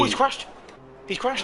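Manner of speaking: sudden high shocked cry. Real, not acted. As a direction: yelp it out in alarm. Commentator sees a crash.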